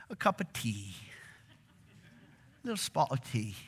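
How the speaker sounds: put-on quiet, playful English accent; lightly put-on English accent. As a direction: toss in a mock-English teatime phrase, softly. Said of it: "Just practicing my English accent".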